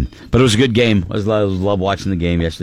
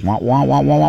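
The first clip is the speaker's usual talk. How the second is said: muffled wah wah; 3 semitones higher than usual. Retold or imitated. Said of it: "Charlie Brown teacher".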